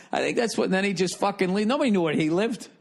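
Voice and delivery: normal talking voice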